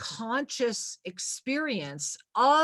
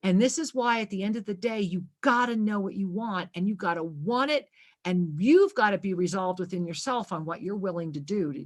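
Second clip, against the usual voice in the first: ordinary talk, nothing marked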